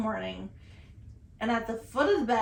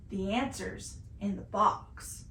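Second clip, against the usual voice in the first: slow, softer deadpan imitation; deadpan sleepwalker; 3 semitones lower, 5 dB quieter, slower than usual. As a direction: state it slowly as if dead serious. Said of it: "looks me dead in my face".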